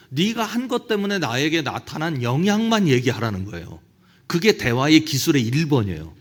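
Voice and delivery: usual speaking voice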